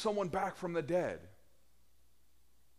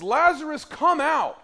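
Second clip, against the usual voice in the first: much louder, higher call to the tomb; loud command; 13 semitones higher, 13 dB louder than usual. Acted. Jesus commanding Lazarus out of the tomb, loud and high. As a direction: call him out with full authority.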